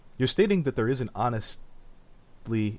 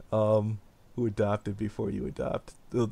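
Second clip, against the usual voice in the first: ordinary talk, nothing marked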